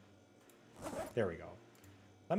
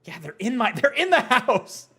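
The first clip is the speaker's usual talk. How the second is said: distressed; 11 semitones higher, 8 dB louder than usual. Real, not acted.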